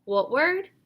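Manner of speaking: brisk teacher question; teacher prompt. Shown as a demonstration. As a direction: prompt the student to blend. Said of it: "prompt that what word moment".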